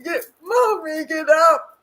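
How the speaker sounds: put-on high, loud child pleading with mommy; little boy's demand. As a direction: plead like a stubborn toddler. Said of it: "He gets up and says".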